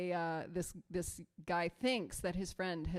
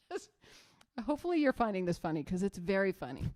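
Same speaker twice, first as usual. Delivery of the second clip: normal talking voice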